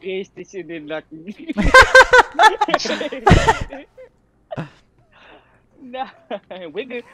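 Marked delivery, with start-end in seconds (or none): 1.3-4.0 loud laughing burst. Real, not acted.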